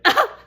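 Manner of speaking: short, high, loud cry of exasperation; overwhelmed yelp. Retold or imitated. Overwhelmed woman standing in her mess, high loud yelp. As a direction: let out a sudden re-enacted yelp.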